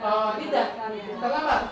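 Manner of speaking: ordinary talk, nothing marked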